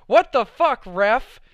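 put-on teen shouting a joke at the ref; joking yell at the ref. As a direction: yell it across the theater like a soccer taunt. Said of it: "just as a joke".

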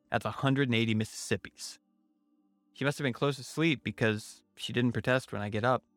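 ordinary delivery

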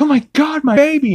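high, panicked mother's cry; high mock panic; frantic Lois. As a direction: shriek for her baby, mock-frantic. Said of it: "Lois is like".

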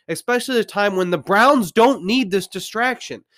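ordinary delivery

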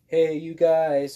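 put-on loud, high, slow shout like the Goonies kid; Goonies call. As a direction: stretch it out and call it across the room, Goonies style. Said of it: "just like from the Goonies".

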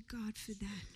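soft whispered prayer; whispered thanks. Pretend. Believer thanking God, a hushed whisper.

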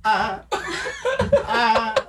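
high, strained little cry-gasps, half laughing; silent crying gasps. Retold or imitated. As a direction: imitate stifled sobs after a spanking. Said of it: "you're just like".

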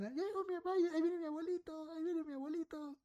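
put-on high child voices calling out excitedly; kids shouting. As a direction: yell like little kids spotting grandpa. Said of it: "empiezan a gritar". (niños gritando con voz aguda emocionados)

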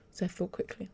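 ordinary delivery, nothing marked

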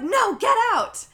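high, loud, slowed retold scream. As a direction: yell it out like a frustrated man chasing her away. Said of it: "And he screams".

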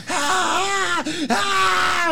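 high, loud mock scream of terror; retold terrified scream. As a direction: scream out like waking from a nightmare. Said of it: "jumps up screaming bloody fucking murder".

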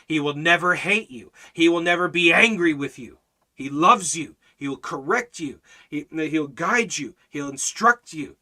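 loud, driving, emphatic litany; impassioned preaching. Real, not acted. Preacher assuring believers God will never leave, loud and impassioned. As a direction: hammer home each promise with force.

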